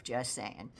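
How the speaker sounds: usual speaking voice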